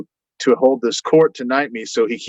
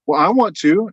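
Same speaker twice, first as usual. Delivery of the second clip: insistent quote; 8 semitones higher than usual. Retold or imitated. Phoebe insisting.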